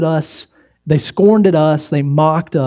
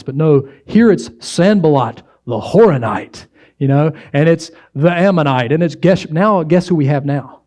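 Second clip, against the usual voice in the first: usual speaking voice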